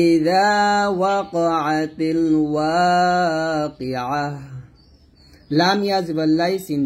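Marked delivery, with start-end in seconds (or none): 0.1-4.2 slow, drawn-out melodic tajweed chant; slow Quranic recitation. Shown as a demonstration. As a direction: recite it slowly and reverently, stretching each vowel. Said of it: "আবার বলেন আমার সাথে".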